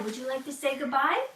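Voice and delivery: ordinary talk, nothing marked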